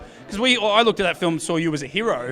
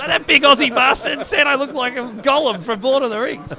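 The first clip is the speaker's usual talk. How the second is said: higher, louder mock Scottish voice playing the offended guest; mock Scottish grumble; 7 semitones higher, 5 dB louder than usual. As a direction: put on a cheeky Scottish accent to tease. Mocking what the guest thinks.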